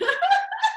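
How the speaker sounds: high, loud put-on laugh; fake laugh. Showing the fake laughing that gets you into state, high put-on laugh. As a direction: force out a fake laugh on purpose. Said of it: "with the fake laughing".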